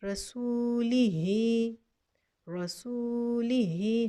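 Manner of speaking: रसूलिही very slow, the long "hee" at the end; recited word. Shown as a demonstration. Teacher sounds out the joined form for students. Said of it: "हा के नीचे देखिए खड़ी ज़ेर है".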